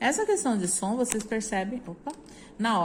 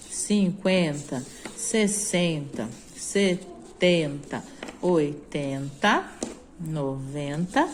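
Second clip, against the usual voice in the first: tens counted out slowly; slow counting; 3 semitones lower, slower than usual. Shown as a demonstration. Teacher counting tens slowly with the class. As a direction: count each ten slowly and evenly. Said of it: "counting tens for pupils".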